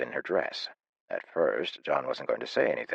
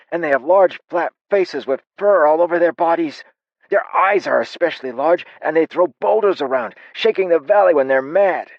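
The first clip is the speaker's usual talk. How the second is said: put-on high, loud eager child telling of monsters; a wide-eyed kid piling on scary details; young boy bursting to tell about the Grimm; excited young boy; 14 semitones higher, 10 dB louder than usual.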